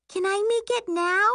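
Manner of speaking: put-on very high child's plea; high-pitched eager child; eager little girl. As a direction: ask like a kid who can't wait. Child eager to make bracelet.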